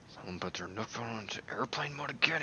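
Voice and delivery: whispered grumble under the breath; muttered aside. Retold or imitated. Villager grumbling about interference, whispered mutter. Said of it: "mutters under his breath".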